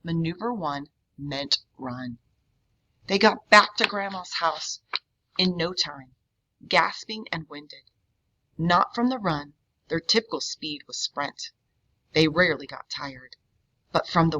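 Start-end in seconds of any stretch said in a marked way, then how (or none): none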